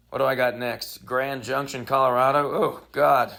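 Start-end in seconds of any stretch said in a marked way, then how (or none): none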